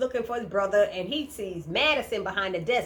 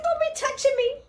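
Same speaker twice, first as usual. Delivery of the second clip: mock Hannah warning. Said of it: "She said".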